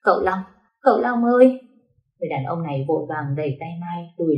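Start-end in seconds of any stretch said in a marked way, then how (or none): 0.0-1.6 rising into a loud crying wail; crying out his name in tears. A narrator's reading. Jilted girl crying out to her master, loud sobbing call. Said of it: "khóc như mưa".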